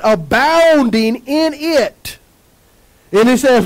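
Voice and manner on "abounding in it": Abounding drawn out slowly, word by word; slow preacher's emphasis on Abounding; stretched slowly. Shown as a demonstration. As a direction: lean into the word Abounding and take your time.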